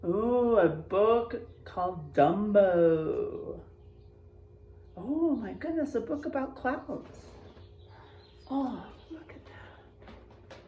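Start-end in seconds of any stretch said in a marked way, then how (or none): none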